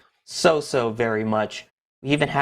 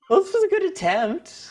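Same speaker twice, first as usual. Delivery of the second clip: amused. Real, not acted. Laughing after failed search.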